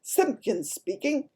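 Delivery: slow, high, self-important phone greeting; important telephone voice. A narrator's reading. Father answering the home telephone, self-important. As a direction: answer slowly with great importance. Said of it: "a very important telephone voice".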